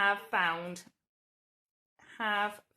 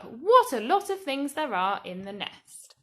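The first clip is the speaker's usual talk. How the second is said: a bit louder exclamation; mild exclamation; 3 semitones higher, 6 dB louder than usual. Shown as a demonstration. Teacher rereading the sample answer, mildly exclaiming. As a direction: exclaim it again, lighter. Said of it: "That would start with what".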